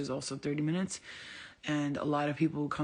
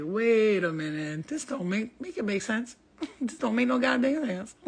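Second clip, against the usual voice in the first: slow at first, then rising high and loud in disbelief; half-laughing outrage at getting locked up; retold disbelief; 5 semitones higher, 4 dB louder than usual. Retelling her arrest while sober, in outraged disbelief. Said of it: "I was like".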